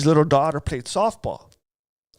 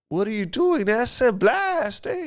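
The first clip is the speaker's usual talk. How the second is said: retold desperate yell, much higher pitch; urgent yell to cousin; 9 semitones higher than usual. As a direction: shout up at him from the floor, pleading. Shot, urging cousin to shoot.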